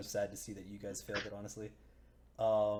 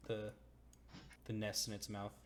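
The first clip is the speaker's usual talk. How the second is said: usual speaking voice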